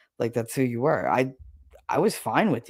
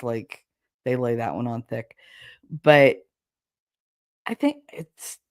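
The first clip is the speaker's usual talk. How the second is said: normal talking voice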